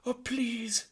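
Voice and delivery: put-on very high, loud French-accented mother; haughty French lady; French mother mouse. Antoinette answering her husband, high French haughty.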